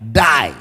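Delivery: DIE said alone, low and forceful; emphatic, falling DIE; stress on DIE. Shown as a demonstration. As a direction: land hard on DIE, pitch dropped. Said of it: "Again, the emphasis is there".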